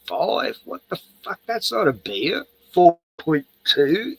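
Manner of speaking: mock outrage, pitch jumping up; incredulous scoff. Real, not acted. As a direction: scoff at it like it's an insult. Scoffing at weak beer strengths.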